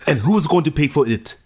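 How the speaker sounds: high, loud mock African accent; botched Caribbean accent sounding African. Co-host mimicking Bilal's fiancée, mock African accent. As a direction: do a bad island accent that comes out African. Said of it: "That's an African accent".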